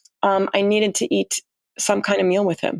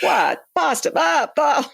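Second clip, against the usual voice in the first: mock outraged Paolo; 7 semitones higher, slower than usual. Imitating Paolo scorning packet lasagna.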